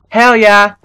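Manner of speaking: loud victory cheer; triumphant cheer. Real, not acted. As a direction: shout out the win. Celebrating a ghast kill.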